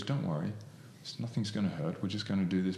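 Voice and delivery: softly imitated reassuring therapist; soothing hypnotist. Hypnotist reassuring people stuck in the chair, quietly soothing. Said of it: "She's a psychotherapist and a hypnotist".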